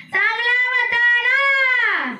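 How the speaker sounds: high, slow, sung lament of village women; mourning wail. Retold or imitated. Preacher imitating old women wailing over a dead man. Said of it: "काय सुरू करतात?".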